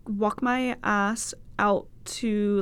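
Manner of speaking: usual speaking voice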